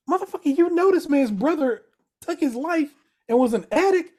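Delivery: exasperated outburst. Retold or imitated. Fan yelling at a TV character, exasperated and loud. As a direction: shout at the screen in disbelief. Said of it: "I'm just like".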